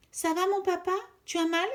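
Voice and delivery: anxious little voice; worried. Acted. Son checking on his hurt father, high and worried. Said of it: "s'inquiète Pitou".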